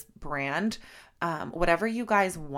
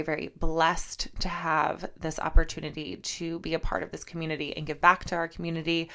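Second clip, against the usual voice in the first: usual speaking voice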